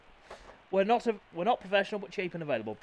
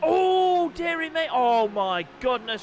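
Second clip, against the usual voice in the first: high, loud exclamation fading to slow dismay; shocked commentator; 11 semitones higher, 5 dB louder, slower than usual. Acted. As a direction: gasp it, then slowly shake your head. Reacting to another crash.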